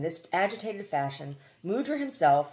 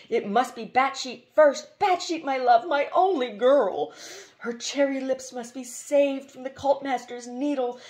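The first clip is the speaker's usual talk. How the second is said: higher and louder, pleading for his love; impassioned cry; 8 semitones higher, 5 dB louder than usual. A narrator's reading. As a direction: cry out stubbornly for his princess. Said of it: "he cried".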